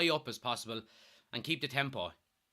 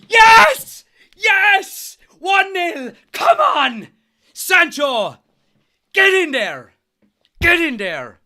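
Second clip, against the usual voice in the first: full-throated real shouting, much higher and louder; goal celebration; 18 dB louder, much slower than usual. Real, not acted. Fan celebrating his team's goal, roaring. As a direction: roar it out like the ball just hit the net.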